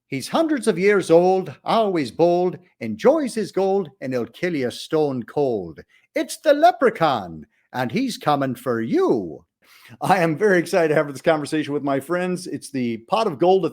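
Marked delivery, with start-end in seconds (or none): 0.1-9.4 rhymes punched out, rising to a dramatic peak; trailer tagline. Acted. Host closing the intro with a spooky rhyming tagline, trailer style. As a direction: sell the rhyme like a horror movie trailer.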